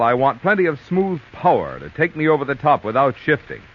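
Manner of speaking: normal talking voice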